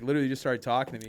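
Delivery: ordinary talk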